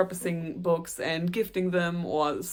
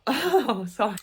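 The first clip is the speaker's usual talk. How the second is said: laughing oh; 4 semitones higher, 4 dB louder than usual. Real, not acted. Laughs at her own slip.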